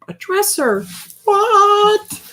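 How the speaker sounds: high squeaky disbelieving what; jokey outraged disbelief; mock surprise. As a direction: pretend to be shocked. Mock shock at a dresser.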